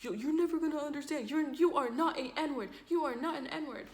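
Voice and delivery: high-pitched acted rant; going off. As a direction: imitate him ranting, voice pitched right up. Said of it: "he's, like, going off".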